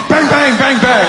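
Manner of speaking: loud, high bang bang; comic gunfire noises; mock gunshots. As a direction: fire off like shots. Gunshot imitation.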